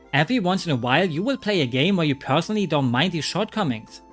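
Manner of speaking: ordinary talk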